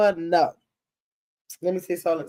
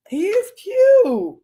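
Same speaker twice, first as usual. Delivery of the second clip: much higher, very slow cooing; 12 semitones higher, 4 dB louder, much slower than usual. Real, not acted. Grandma cooing at Rocky's photo in a high, slow voice.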